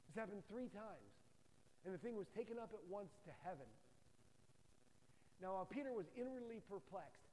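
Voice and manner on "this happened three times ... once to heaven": ordinary talk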